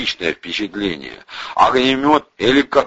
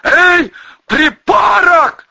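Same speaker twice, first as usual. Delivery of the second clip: high, drawn-out full shout; shouting from afar; 7 dB louder, much slower than usual. A narrator's reading. Vovka calls to the gunman from afar, shouting. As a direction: yell it across a distance. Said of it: "закричал ему Вовка издали".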